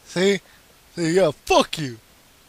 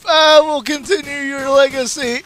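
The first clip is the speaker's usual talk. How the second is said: mock heroic vow; 8 semitones higher, 5 dB louder than usual.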